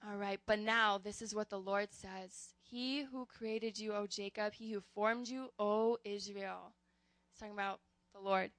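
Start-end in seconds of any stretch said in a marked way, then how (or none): 2.6-6.7 slow, measured reading of scripture. Acted. Pastor reads Isaiah to the congregation, slow and measured. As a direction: read each phrase slowly and deliberately.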